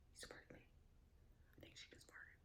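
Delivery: hushed whisper. Real, not acted. Telling viewers about the dog, whispering. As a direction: whisper it like a secret.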